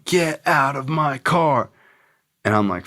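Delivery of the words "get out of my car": high, slow mock yell; imitated screaming. Friend screaming at him to leave the car, mock yell. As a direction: stretch out the friend's scream without full volume. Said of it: "he's screaming".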